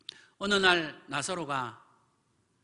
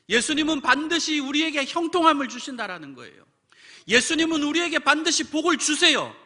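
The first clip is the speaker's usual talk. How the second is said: much higher, louder preaching cry; preaching at full force; 10 semitones higher, 9 dB louder than usual. Acted. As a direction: proclaim it to the whole room. 설교를 힘껏 외침. (힘차게 외치는 설교)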